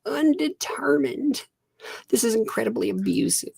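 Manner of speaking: mocking quote. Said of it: "Mister Butler responded".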